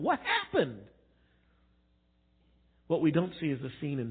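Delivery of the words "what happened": high-pitched, stunned question; mock dazed disbelief; bewildered Job. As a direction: let the pitch leap up in acted bafflement. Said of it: "and it's just going".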